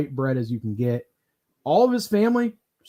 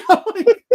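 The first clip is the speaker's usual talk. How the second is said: high-pitched burst of laughter; 4 dB louder than usual. Real, not acted. Laughing hard at a friend's nickname, high real laughter. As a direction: crack up in a squeal of real laughter.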